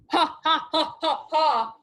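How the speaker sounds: loud villain's ha ha ha; evil laugh. Acted. Villain Kans laughing at his prisoners, loud and evil. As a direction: laugh like a wicked king. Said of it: "give me an evil laugh".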